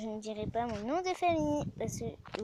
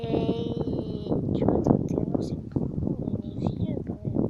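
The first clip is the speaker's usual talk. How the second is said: mock rasp; 6 dB louder than usual. Teasing brother's age.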